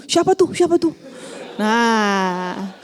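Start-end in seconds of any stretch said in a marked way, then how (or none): 0.1-0.9 high, eager prying question; pry like a gossip hungry for names; nosy gossip. Retold or imitated. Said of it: "Jangan nanya who". (nada kepo yang tinggi)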